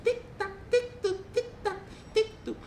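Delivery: high squeaky tik-tak hoofsteps; goat footsteps. Acted. Goat's steps on the bridge, high pattering tik-tak voice. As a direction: imitate little hooves in a very high voice. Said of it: "Bunyi, eh, ta-, jalan kakinya".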